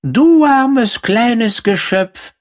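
hohe, langsame Mäusestimme; piepsig-mitleidige kleine Maus; Feldmaus. A narrator's reading. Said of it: "sagte die Feldmaus". (hohe, mitleidige Mäusestimme)

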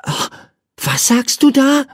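put-on very high, wondering child's voice; curious boy. A narrator's reading. Michael questions the bird at his window, high and curious. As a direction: ask like an intrigued little boy. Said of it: "fragte ihn Michael neugierig".

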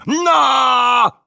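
sudden high loud yelp as he falls; loud cry. A narrator's reading. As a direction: blurt it out loud and high while crashing down. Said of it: "mit einem lauten".

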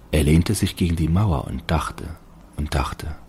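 ordinary delivery, nothing marked

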